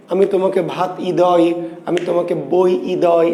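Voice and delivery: "ই দয়" said as separate sounds, ই first; wrong split reading. Shown as a demonstration. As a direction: pull ই away from দয়, letter by letter. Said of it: "ই দয় না".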